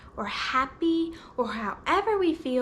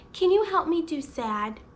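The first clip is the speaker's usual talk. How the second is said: usual speaking voice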